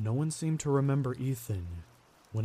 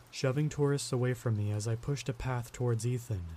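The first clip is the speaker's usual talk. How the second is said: ordinary delivery, nothing marked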